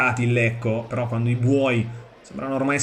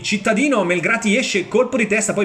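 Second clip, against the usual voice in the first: higher-pitched rush of the action; excited match call; 6 semitones higher than usual. Real, not acted. Commentator calls keeper coming out for a header, excited. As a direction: raise the pitch as the keeper comes out.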